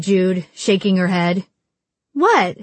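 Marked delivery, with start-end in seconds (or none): 2.1-2.6 quick, high-pitched comeback; defensive retort. A narrator's reading. Mallory firing back at Jude's disapproval, defensive retort. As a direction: shoot it straight back, pitched up. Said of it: "shot Mallory".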